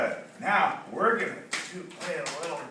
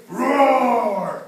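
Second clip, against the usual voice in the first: long loud roar, falling away; animal roar; 3 semitones higher, 8 dB louder than usual. Acted. Storyteller showing children jungle sounds, roaring. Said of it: "They didn't say".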